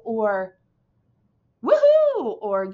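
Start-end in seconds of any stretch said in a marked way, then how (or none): 1.6-2.4 high, loud cheering woohoo; teacher cheering an example exclamation; excited. Shown as a demonstration. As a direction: whoop it like a celebration. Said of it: "those are all exclamations".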